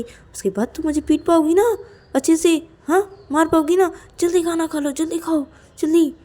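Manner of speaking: high, insistent character voice; urgent coaxing. Acted. Ananya hurries hungry Payal to eat, urgent coaxing.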